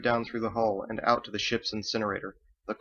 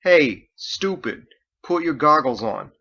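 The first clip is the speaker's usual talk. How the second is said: loud, slow and higher, scolding; exasperated shout; 5 semitones higher, 8 dB louder, slower than usual. Acted. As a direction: bark it slowly at the kid. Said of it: "repeated himself".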